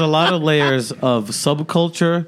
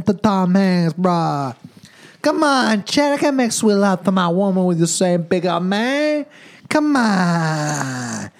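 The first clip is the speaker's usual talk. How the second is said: hammed-up Jamaican accent, higher voice, slowed on the pleas; mock Jamaican patois; 5 semitones higher, slower than usual. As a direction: scold Chet in a put-on Jamaican accent, pleading. Mocking Chet's patois as Jamaican.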